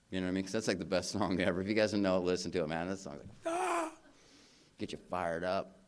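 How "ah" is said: high, drawn-out excited yell; a pumped-up concert shout. Retold or imitated. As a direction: let out a fired-up rock cry. Song gets him fired up.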